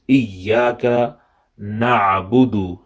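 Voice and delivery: ordinary talk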